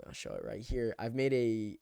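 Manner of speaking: usual speaking voice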